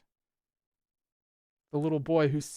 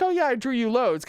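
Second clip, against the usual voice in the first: higher, louder offhand mimic; mock casual quote; 9 semitones higher, 6 dB louder than usual. Reader mimicking the character offhandedly, mock casual. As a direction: toss it off mockingly. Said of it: "And then it's like".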